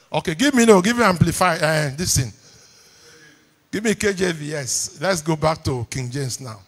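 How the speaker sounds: usual speaking voice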